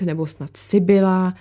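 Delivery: plain reading voice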